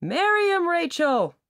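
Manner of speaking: bystander yelling high and loud from a distance; shouted. A narrator's reading. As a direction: cheer the proposal on from two benches away. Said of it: "shouted a young man sitting two benches away".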